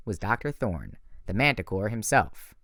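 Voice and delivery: usual speaking voice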